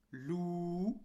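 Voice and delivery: "lou" with a long, held ou; prolonged vowel. Shown as a demonstration. Teacher reading a syllable aloud, prolonged vowel. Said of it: "de prolongation".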